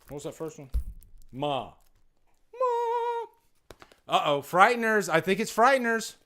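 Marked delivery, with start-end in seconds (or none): 2.5-3.2 playfully stretched "Ma"; drawn-out title. Pretend. Streamer repeating a film title to viewers, playfully drawn out. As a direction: hold "Ma" out in a put-on voice.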